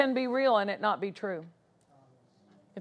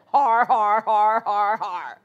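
hearty mock "har har" laugh; Brother Hagin's put-on laugh; 2 semitones lower, 4 dB louder, slower than usual. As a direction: force out evenly spaced, growly-r guffaws. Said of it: "And to hear Brother Hagin".

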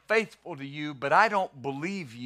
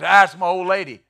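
dismissive husband; 9 dB louder, faster than usual. Retold or imitated. Mocking a husband dismissing his wife.

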